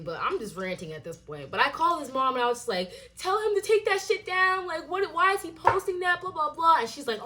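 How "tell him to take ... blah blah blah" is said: retold demand in a high, agitated voice; worked-up, high-pitched retelling of a complaint; indignant demand. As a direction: replay an exasperated complaint, pitched well up. Retelling angry call to mom.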